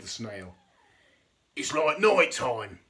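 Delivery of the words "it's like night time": louder and slow, a mild cry; a sea urchin crying out, lightly hammed; cried out. A narrator's reading. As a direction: call it out in the dark. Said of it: "cried the sea urchin".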